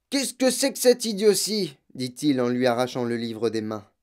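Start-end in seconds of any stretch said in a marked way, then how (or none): 0.1-1.8 high, louder, contemptuous bark; angry father. Acted. Father snatching his daughter's book, high and scornful. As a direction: sneer at the book and grab it.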